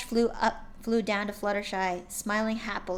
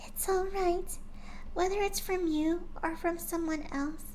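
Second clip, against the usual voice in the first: higher, softer timid pony voice; gentle shy pony; 9 semitones higher, 4 dB quieter than usual. A narrator's reading. Shy pony gently coaxing her friend, soft and high. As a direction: speak like a shy gentle mare.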